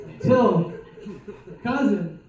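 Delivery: normal talking voice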